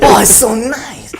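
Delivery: slow, high, bad Korean accent; a terrible Korean accent, drawn out. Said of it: "That's a terrible Korean accent".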